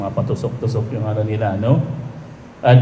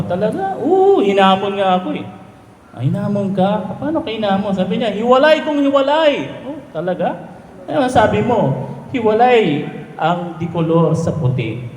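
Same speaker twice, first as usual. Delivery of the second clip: lightly voiced buddies, higher pitch; drinking buddies trading a challenge, higher mock voices; high joke voices; 10 semitones higher, faster than usual. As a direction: banter like gossiping friends.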